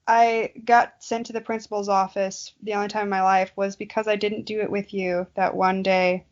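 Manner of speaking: ordinary delivery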